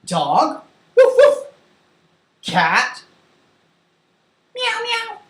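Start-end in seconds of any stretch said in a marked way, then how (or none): 1.0-1.5 high, loud puppy woofs; two short, high-pitched barks; dog bark. Shown as a demonstration. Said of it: "Tell me how it goes". 4.5-5.2 two thin, high meows; cat meow. Shown as a demonstration. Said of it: "Tell me how it goes".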